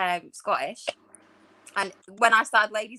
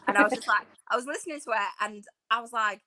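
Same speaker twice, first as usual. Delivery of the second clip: ordinary talk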